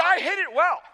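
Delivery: defensive insistence. Retold or imitated. Retold insistence to doubting son.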